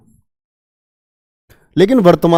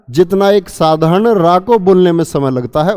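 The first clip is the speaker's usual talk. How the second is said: ordinary talk, nothing marked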